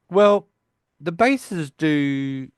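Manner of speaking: high, drawn-out hesitant quote; acted awkward hedging. Voicing Howard's hesitant explanation.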